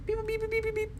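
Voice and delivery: high, even beeps; little monotone machine beeps; imitated beeping. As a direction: beep rapidly on one high note.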